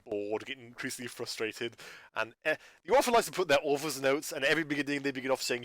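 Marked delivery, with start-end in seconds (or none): none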